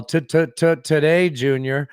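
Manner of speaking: mock stammer into "today, Junior"; mocking stutter. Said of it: "he's making fun of his stutter".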